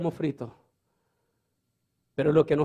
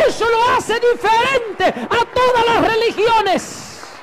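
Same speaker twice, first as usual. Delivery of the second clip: much higher and louder, shouted sermon, slowing down on key lines; fiery preaching; 7 dB louder than usual. Acted. Preacher retelling Antonio's conversion to the church, shouting with fervor. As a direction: preach it at full force, building to a shout.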